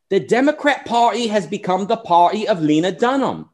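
a British commentator's accent, mildly imitated; Milo's British accent. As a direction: put on a posh-leaning British accent for the quote. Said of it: "the accent sounds more like Sargon than Milo".

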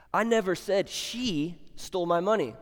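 "she" stretched out, then a pause; single out "she" as the surprising word; stress on SHE. Shown as a demonstration. Preacher showing a totally different meaning, stress on "she". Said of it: "That's different, totally different".

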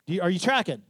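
ordinary talk

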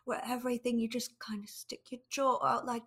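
jaw jutted forward, talking through it; underbite. Shown as a demonstration. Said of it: "she was doing the, like, talking through your jaw thing that Keira Knightley does".

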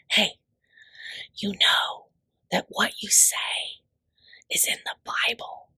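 hushed, secretive whisper with long pauses; close-up whisper. Retold or imitated. Audience member confiding in the speaker after a talk, whispering. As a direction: whisper it right into someone's ear. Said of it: "they'll come in my ear".

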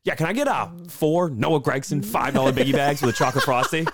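high, bouncy drive-thru order; imitating friend ordering. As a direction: order up high with mock seriousness. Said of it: "He's like".

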